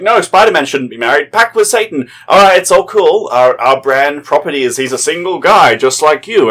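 loud, high, sarcastic sales pitch; mocking imitation. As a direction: parody a publisher justifying a silly retcon. Mimicking publisher's reasoning about the character.

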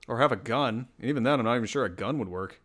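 ordinary delivery

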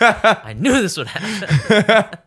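high, loud, slow, laughing through the words. Real, not acted. Listener reacting to the surprise story, laughing slowly. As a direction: crack up while saying it.

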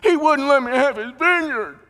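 high, loud petulant whine; whiny Ahab. Acted. Ahab whining to his wife about the vineyard, high and loud. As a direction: whine like a sulking child king.

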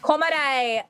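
put-on Komodai in an Australian accent, falling. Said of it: "your Australian accent".

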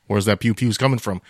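ordinary talk, nothing marked

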